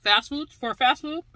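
talking between bites; chewing. Real, not acted. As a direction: mouth partly full. Seen 1: bit burger at -4s, puffed cheeks, lips pressed, wiping mouth.

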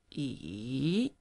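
E dipping low then rising; third tone e. Shown as a demonstration. Teacher models the dipping third tone. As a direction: let E fall then climb back up. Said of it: "falling, rising".

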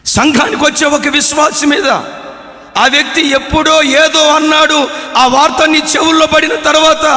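forceful questioning sermon delivery; fiery preaching. Acted. Pastor challenging the congregation about grudges, preaching hard. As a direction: press each question on the listeners like an accusation.

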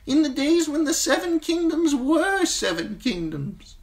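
an ancient maester teaching a hard lesson; old maester voice. Acted. Old maester lectures Jon on the Night's Watch vows, high and grave. Maester Aemon's long speech.

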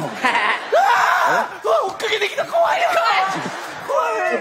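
high, laughing mock scream; fleeing from the kids, high laughing squeals; laughing fright. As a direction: squeal and laugh while being chased. Chased, laughing and scared.

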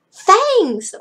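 high, loud shout of discovery. Acted. Frankie spots Lala's fangs over loud music, shouting. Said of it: "Frankie shouted over the music".

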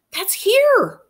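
high, loud blurt of recognition; excited blurt. A narrator's reading. Girl interrupting to say it's her town, excited blurt. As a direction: pipe up eagerly. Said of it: "Chelsea said aloud".

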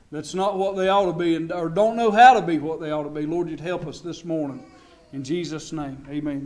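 low, soft, earnest prayer; opening prayer. Real, not acted. Preacher asks the Lord to help the service, hushed prayer. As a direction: pray quietly over the congregation.

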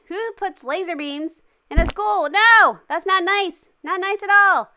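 loud, high-pitched protest; playful indignation. Pretend. Player objects to laser beams in school, playful indignation. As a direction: scold in hammed-up outrage.

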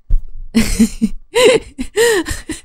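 laugh. Real, not acted. Laugh at outro.

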